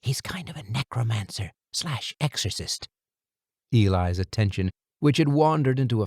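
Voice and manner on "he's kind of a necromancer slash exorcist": softer secretive hush; whisper. A narrator's reading. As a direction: lean in and hush it. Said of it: "He lowered his voice to a whisper".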